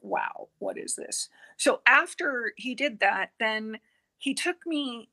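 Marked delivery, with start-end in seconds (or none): 0.0-1.3 hushed whisper of wonder; whispered awe, lower and soft; awed whisper. Retold or imitated. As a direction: breathe it out, stunned by the light. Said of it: "I was like".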